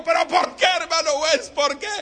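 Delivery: high, loud desperate why; loud high protest. Acted. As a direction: yell it in disbelief. Chanito protests again.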